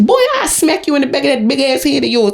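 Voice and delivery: usual speaking voice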